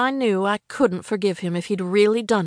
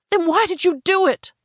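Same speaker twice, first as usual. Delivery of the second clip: higher-pitched, nagging demand; nagging girlfriend; 9 semitones higher, 4 dB louder than usual. A narrator's reading. Hurt girlfriend questioning her boyfriend, nagging. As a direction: press him for a reason, voice raised in pitch. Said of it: "for sounding like a nagging girlfriend".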